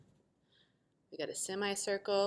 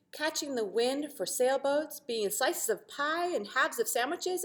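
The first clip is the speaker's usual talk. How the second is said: put-on lively read-aloud for children, bright and animated; storytelling voice; 6 semitones higher, 6 dB louder than usual. A narrator's reading. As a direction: read it like a picture book to a class. Teacher reads story aloud.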